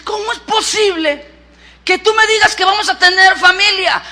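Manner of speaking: high-pitched, astonished protest; incredulidad. Acted. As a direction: exclaim it in sheer disbelief. Sara no cree la noticia. (asombro incrédulo en voz aguda)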